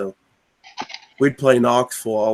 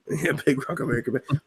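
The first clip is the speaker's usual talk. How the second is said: laughing repeat; 2 semitones higher, 6 dB quieter, faster than usual. Real, not acted. Laughing while repeating joke.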